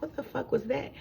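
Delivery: high, hushed disbelief; quietly baffled, pitch jumping up; retold bewildered reaction. Retold startled reaction to boom.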